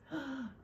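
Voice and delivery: short acted gasp; storybook gasp. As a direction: gasp for the disappointed turkey. Turkey reacts to elf's refusal.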